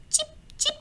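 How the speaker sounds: tiny, high, soft chipping; squeaky little chip chip; high chip sound. A narrator's reading. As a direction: peep it like a cracking egg. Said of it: "he hears a chip".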